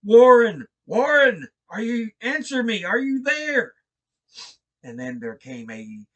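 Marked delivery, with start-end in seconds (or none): 0.0-3.8 very loud, high scream down the phone; frantic high scream into the telephone; screaming his name. Acted. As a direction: scream into the receiver for an answer. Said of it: "and screamed".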